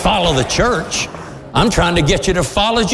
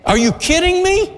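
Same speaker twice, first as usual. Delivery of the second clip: high, fervent preacher's appeal; preaching at full force; 10 semitones higher than usual. Acted. Preacher pleads with congregation, high fervent preaching. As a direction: press the point on the congregation.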